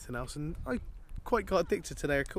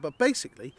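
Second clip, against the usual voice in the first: ordinary talk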